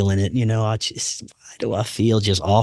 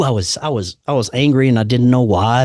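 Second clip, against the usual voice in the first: normal talking voice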